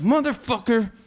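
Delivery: high-pitched exasperated mock rant; retold angry rant. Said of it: "So I'm getting angry".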